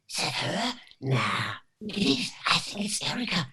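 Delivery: hushed English voices, retold; overheard English onlookers. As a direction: imitate diners debating under their breath. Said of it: "hearing an English voice saying".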